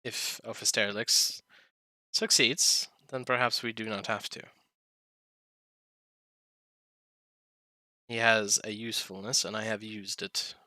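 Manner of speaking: a hint of a solemn old dragon; lightly voiced dragon, measured and grave; dragon character voice. Dragon character Vershik speaks in-game.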